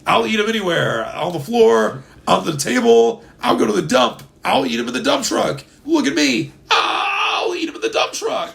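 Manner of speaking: high, loud manic raving; excited wild-eyed fan yelling; manic Skittles fan. Pretend. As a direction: rave about eating Skittles anywhere, climbing to a shriek. Acting out a Skittles-crazed character.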